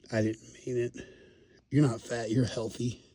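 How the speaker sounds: normal talking voice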